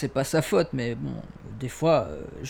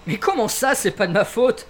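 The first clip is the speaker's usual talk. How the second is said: higher-pitched offended protest; indignant; 8 semitones higher, 4 dB louder than usual. Acted. Suspect objecting to the officer's pity, indignant. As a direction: protest in rising exasperation.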